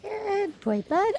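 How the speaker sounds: very high praise voice; dog praise. Real, not acted. Dog sitter praising Charcoal, very high.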